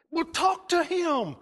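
high, slow, pushing wife voice; insistent wife. Retold or imitated. Wife pushing her husband to talk to the preacher, high and insistent. Wife urging him to speak.

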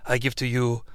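low, soft, hushed mock-tender farewell; hushed overly tender father figure; tender mentor voice. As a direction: ham up a sappy heartfelt goodbye. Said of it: "share a very tender moment".

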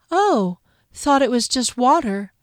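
lightly dreamy and detached. A narrator's reading. Dazed Fennel shrugging off his head wound, dreamy. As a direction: float it, half there. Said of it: "He sounded dreamy".

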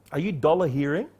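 ordinary delivery

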